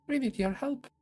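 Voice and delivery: tiny ant voice. Acted. Ant character voice.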